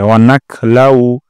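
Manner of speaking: "নাখলাউ" with a throaty খ, not কাফ; slow, scrape the kh from the throat; guttural kh sound. Shown as a demonstration. Teacher correcting a common কাফ mistake. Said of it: "খ উচ্চারণ করতে হবে".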